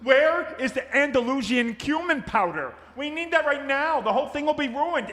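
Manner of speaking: high, panicky yelling at Ma; frantic chef in a kitchen crisis; frantic shouting. Acted. Rebound effect, frantic cook yelling.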